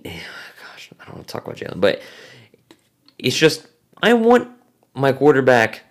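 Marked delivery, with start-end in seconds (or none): 0.0-1.8 sighing whispered aside. Real, not acted. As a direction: breathe it out in a weary whisper. Exasperated aside about Jalen.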